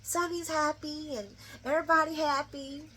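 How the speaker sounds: high, soft mock airy excuse; mock blithe woman, high and small; imitating her. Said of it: "this is her".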